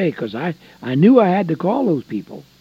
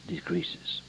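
dramatic whisper; 5 semitones higher, 13 dB quieter than usual. Real, not acted.

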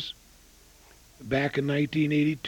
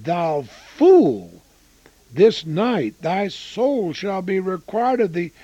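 ringing rebuke; 3 semitones higher, 6 dB louder, slower than usual. Acted. Preacher reads God's rebuke.

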